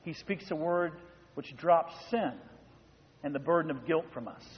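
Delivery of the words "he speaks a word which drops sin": ordinary talk, nothing marked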